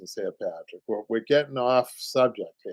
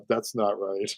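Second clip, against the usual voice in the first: ordinary delivery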